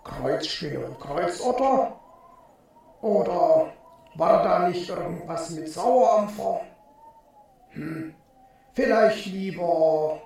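muffled, hesitant thought voice; pondering inner voice. A narrator's reading. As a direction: think it over inside your head, with pauses. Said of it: "grübelte er". (grübelnde Gedankenstimme)

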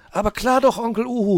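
put-on a hedgehog child rushing off shouting; calling out. A narrator's reading. Borstel calls to Onkel Uhu while rushing to the telescope. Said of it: "rief Borstel".

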